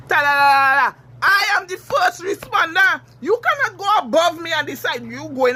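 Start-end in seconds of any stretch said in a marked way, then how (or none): 0.1-0.9 louder, sing-song taunt of nonsense syllables; mock them with a silly la-la tune; mocking singsong.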